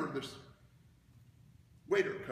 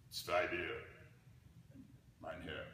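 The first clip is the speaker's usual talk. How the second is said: German waiter; 7 semitones lower, slower than usual. Retold or imitated. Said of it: "the waiter looks at him and says".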